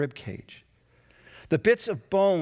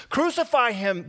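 raised demand to crucify; shouted; 6 semitones higher than usual. Acted. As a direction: yell it like a mob. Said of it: "they shouted".

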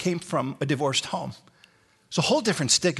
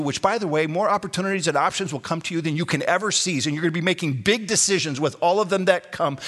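usual speaking voice

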